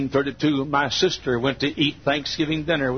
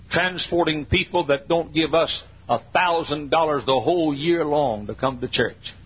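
ordinary talk, nothing marked

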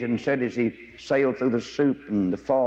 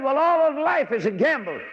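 mock know-it-all; 13 semitones higher, 3 dB louder than usual. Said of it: "Somebody said".

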